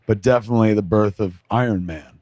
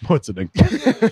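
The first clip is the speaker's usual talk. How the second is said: laughing echo; 5 semitones higher than usual. Real, not acted. Echoing the question, laughing.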